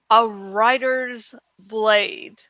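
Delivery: usual speaking voice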